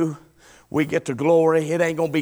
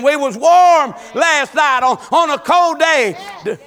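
higher and louder, shouting the key lines; preaching at full force; 7 semitones higher, 4 dB louder than usual. Acted. Preacher urging the congregation to store up praise, fiery preaching. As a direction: drive it home like a revival preacher.

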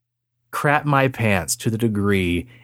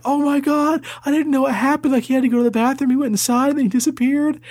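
high, breathless panicked teacher; frantic teacher; 13 semitones higher, faster than usual. Retold or imitated.

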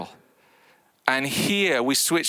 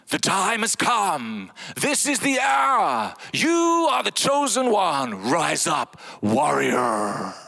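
God calling Jesus the chosen warrior, booming; epic God voice; 3 semitones higher, slower than usual. Pretend. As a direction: sound like a Marvel trailer god. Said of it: "Here's three of mine".

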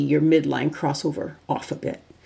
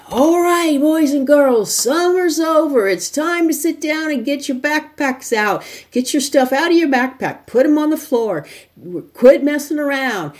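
put-on loud, high, mean old teacher yell; 10 semitones higher, 4 dB louder than usual. Shown as a demonstration. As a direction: holler at the class like a mean teacher. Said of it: "yelling at you".

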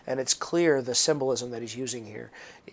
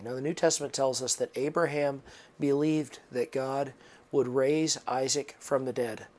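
normal talking voice

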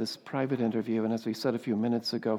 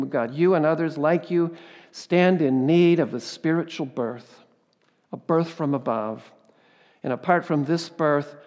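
loud, higher pulpit proclamation; louder, higher impassioned preaching; preaching at full force; 3 semitones higher, 7 dB louder than usual. Acted. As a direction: drive the words home to the crowd. Preaches Jesus' words to Nicodemus.

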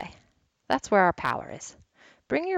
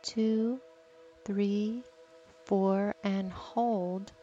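guided meditation; 6 dB quieter than usual. Acted. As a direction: gently count the breaths, calm. Leads a breathing meditation.

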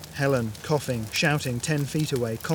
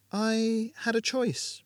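a clearly higher, slow woman's voice; Ramona, serene; 10 semitones higher, much slower than usual. Acted. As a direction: speak with unhurried calm.

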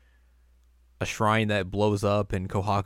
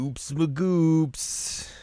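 silly slow voice; 7 semitones higher, much slower than usual. Retold or imitated. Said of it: "Naime's like".

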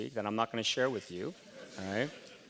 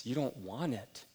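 ordinary talk, nothing marked

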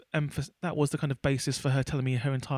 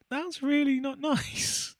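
laughing; 11 semitones higher, much slower than usual. Retold or imitated. Retelling her own reproachful reply.

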